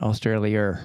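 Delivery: "Australia" ending in an R, "Australi-er"; R added at end. Retold or imitated. American asking what's with that, "Australia" with final R. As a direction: tack an R onto the end of "Australia". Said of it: "some R's thrown at the end of some words".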